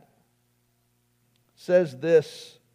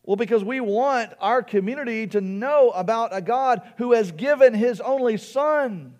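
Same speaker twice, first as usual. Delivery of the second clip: raised, louder preacher's voice; impassioned preaching; 7 semitones higher, 8 dB louder than usual. Real, not acted. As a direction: preach it high and emphatic. Preaches with rising force.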